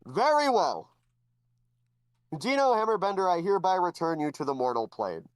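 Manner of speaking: the head god asks the hero to confirm; booming head god. Acted. Head god questioning Dino, grand and formal.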